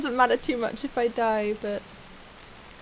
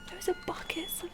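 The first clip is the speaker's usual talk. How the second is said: scared whisper; 4 semitones higher, 7 dB quieter than usual. Real, not acted. Seeing gruesome buckets.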